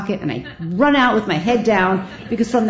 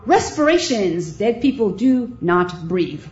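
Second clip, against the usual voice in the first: slow, careful reasoning; slow deliberate; slower than usual. Retold or imitated. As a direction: think it through slowly. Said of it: "inner reasoning".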